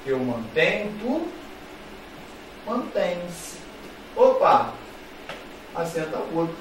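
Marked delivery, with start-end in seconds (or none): none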